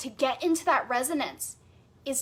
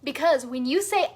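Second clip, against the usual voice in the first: ordinary talk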